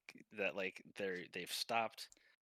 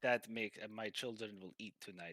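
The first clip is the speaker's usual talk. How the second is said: grateful Russian masseur; 6 dB louder than usual. Acted. Masseur thanks client for a tip, grateful Russian accent.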